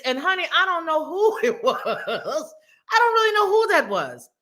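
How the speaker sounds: high, slowed by laughter; real laughing while talking. Real, not acted. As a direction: giggle breaking into the words. Cracking up while telling it.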